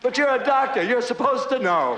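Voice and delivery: high, protesting patient voice; odd high patient voice. Acted. Patient protests to his doctor, in the afflicted high voice. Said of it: "when I started talking like this".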